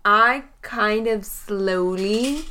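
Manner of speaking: drawn out, inching along; slow creeping. Pretend. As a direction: stretch the words as if sneaking over slowly. Mimes creeping slowly over in game.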